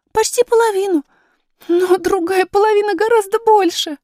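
put-on high girlish voice, daunted by the task; high, piping child's voice; worried young princess. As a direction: fret aloud as a little princess. Princess's figure in the story.

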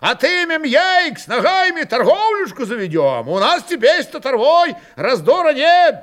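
high, loud, eager urging; crowd shouting. A narrator's reading. Cossacks push for taking Yaik and trading, shouting. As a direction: call the plan out over the din.

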